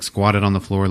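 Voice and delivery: ordinary delivery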